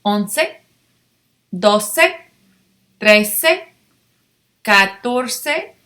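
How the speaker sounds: teacher modeling each number with a rising tune; slow, clear drill of once through veinte; repeat after me. Shown as a demonstration. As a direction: call out each number for the class to echo. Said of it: "Repeat after me".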